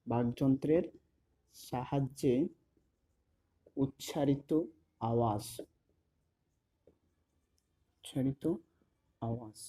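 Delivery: word by word with long pauses; measured, halting dictation pace; slow dictation. Real, not acted. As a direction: dictate it piece by piece for note-taking. Dictating the definition while writing.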